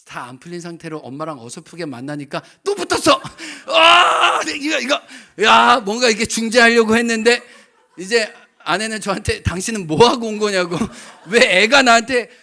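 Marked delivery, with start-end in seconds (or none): 3.7-5.0 much higher, louder pained yelp; loud anguished outburst; anguished cry. Real, not acted. Fight breaks out again. 9.4-12.3 much higher, louder mock scolding; hammed-up angry wife; imitating angry wife. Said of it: "막 또 화를 내는 거야".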